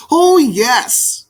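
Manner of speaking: high, bright agreeing cry; eager. Acted. Robin agreeing with Maggie, bright and eager. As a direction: chirp your agreement. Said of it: "nodded the robin".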